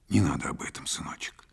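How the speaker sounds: soft, fading whisper; secretive motherly whisper; whispered plea. A narrator's reading. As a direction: plead in a hushed whisper. Mother hushing son about the past.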